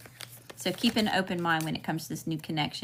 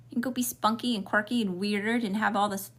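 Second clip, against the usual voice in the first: ordinary talk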